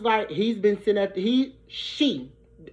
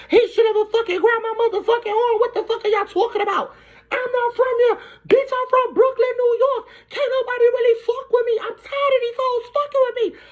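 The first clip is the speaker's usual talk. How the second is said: retold shrieking yell; 13 semitones higher than usual. To security after the fight, high shrieking furious yell. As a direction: shriek it out like you're being dragged off.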